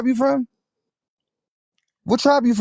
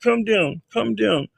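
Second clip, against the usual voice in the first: lightly mock the opponent's repeated phrase; mocking mimicry. Debater parroting his opponent's calm-down refrain, mocking. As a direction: parrot the other man in mild mockery.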